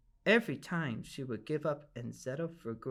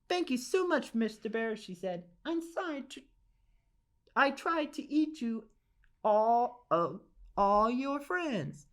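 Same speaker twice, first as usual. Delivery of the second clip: much higher, sheepish thanks; old lady thanking the bear and apologising; grateful old lady; 7 semitones higher than usual. Acted. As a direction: gush thanks, then trail off embarrassed.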